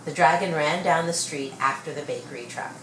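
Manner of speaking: plain reading voice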